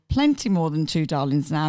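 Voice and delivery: normal talking voice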